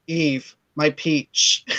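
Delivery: very slow, low endearment; smooth sweet talk. Retold or imitated. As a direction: imitate a husband's slow, syrupy pet name. Imitates Castor sweet-talking the wife.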